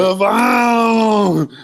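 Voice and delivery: loud, high, strained cry of "the phone"; hammed-up slurred yell; strangled shout. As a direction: force the words out like a drugged man yelling. Drugged DiCaprio, shouted.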